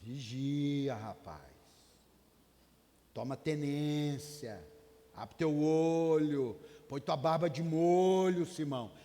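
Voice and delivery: slow, pressing warning to a friend; stern warning. Retold or imitated. Jesus warning Peter to watch out, slow and firm. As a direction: lean in and warn him slowly. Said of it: "é tipo assim".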